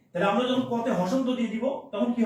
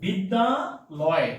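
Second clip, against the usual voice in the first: বিদ্যালয় drawn out very slowly, each syllable separate; much slower than usual. Shown as a demonstration. Teacher spelling out বিদ্যালয় for students, very slow. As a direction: stretch বিদ্যালয় out slowly so students hear its parts. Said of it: "just খেয়াল করেন".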